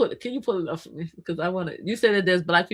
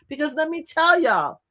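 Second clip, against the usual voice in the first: ordinary delivery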